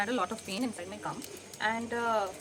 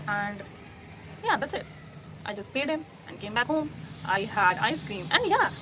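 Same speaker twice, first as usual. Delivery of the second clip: normal talking voice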